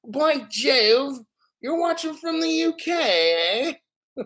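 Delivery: high-pitched hammed-up British accent; mock British accent. Streamer playfully imitating a viewer from the U K in mock British. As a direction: put on a slow, exaggerated British voice. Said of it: "who has the accent".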